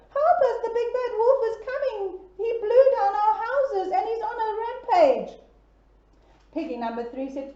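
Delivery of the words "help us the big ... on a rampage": high, loud frightened piglets; panicked squeal. Acted. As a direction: blurt the warning in a panic. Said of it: "they squealed".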